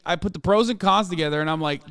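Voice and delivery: ordinary talk, nothing marked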